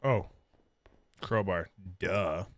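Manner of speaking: usual speaking voice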